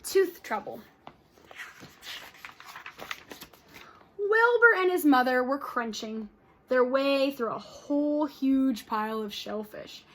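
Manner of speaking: lively read-aloud with character voices; storybook read-aloud for kids; reading to children. Acted. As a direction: read it to the class with expression. Teacher reads story aloud to class.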